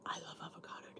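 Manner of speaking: hushed food confession; whisper. Real, not acted. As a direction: breathe it like a secret craving. Whispered aside about food.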